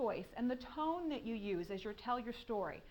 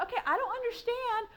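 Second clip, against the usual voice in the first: pitched-up, pleading retold frustration; frustrated author quoting herself, higher; 8 semitones higher than usual. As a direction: whine it like a lost new writer. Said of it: "And I was just so frustrated".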